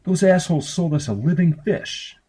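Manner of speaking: a lightly voiced annoyed boy; indignant brother. A narrator's reading. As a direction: gripe about being cheated. Brother complains about the fish.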